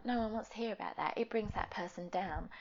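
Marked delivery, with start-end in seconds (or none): none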